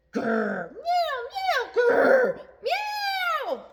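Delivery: dog chasing the cat round the ladder. Acted. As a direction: growl then high, loud meows.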